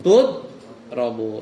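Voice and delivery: Tudrobu said slowly, each syllable clear; slow modelled answer. Shown as a demonstration. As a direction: drag out Tudrobu to show the ta at the start. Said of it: "Jadi apa?". (Tudrobu diucapkan pelan, menekankan ta di depan)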